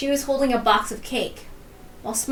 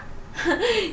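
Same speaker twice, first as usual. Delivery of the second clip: a quick falling giggle; little giggle; 10 semitones higher than usual. Acted. Character laughs again.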